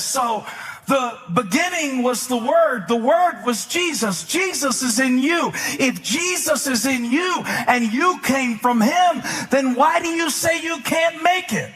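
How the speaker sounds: high, driving sermon cadence; fervent preaching. Real, not acted. Preacher tells believers Jesus is in them, fervent preaching. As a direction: pile up the phrases like a fired-up preacher.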